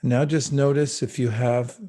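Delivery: calm spoken meditation with long pauses; guided meditation. Acted. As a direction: ease them gently into the breath.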